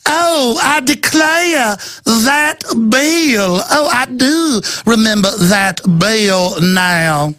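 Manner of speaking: mocking, high, slow Southern Georgia drawl; fake Georgia accent. Host mocks a senator's fake Georgia accent, high and slow. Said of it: "This affected fake Georgia accent".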